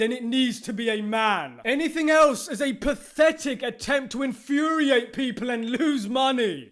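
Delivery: ordinary delivery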